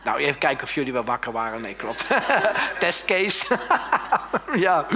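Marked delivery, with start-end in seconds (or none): 2.1-2.7 loud, high burst of laughter. Real, not acted. Laughs at own slip. 3.6-4.5 high, loud laughing fit. Real, not acted. Laughs at own slip.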